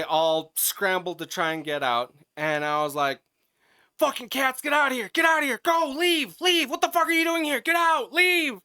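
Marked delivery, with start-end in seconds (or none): 4.0-8.6 high, loud shouting at stray cats to leave; yelling at cats. Retold or imitated. Driver shouting stray cats out of his car, a loud high yell. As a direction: scream them out of the car, pitched way up.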